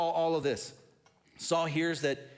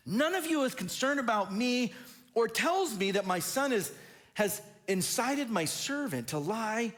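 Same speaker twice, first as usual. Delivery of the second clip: much higher, a wounded whiny king; high indignant whine; 9 semitones higher than usual. Acted. Preacher re-voicing Saul complaining to his officials, high whiny indignation. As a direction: make Saul sound petulant and hurt. Repeat of Saul's line, acted.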